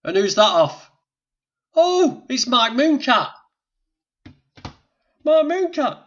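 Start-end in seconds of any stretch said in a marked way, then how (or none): 1.7-6.0 high, loud exclamation; high-pitched whoop of recognition; excited recognition. Real, not acted. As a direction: cry the name out, pitched way up. Exclaims on identifying who is there.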